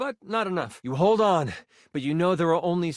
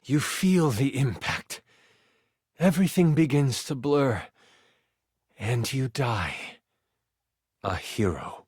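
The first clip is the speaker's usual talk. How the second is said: grave death narration; 6 semitones lower than usual. A narrator's reading. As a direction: let it sink to a very low close. Game master narrates the player's death.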